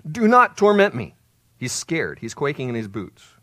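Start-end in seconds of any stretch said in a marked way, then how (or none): 0.1-1.2 high, loud acted plea; emphatic raised-voice begging; loud protest. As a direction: cry it out like a cornered demon. Acting the scared demon's plea.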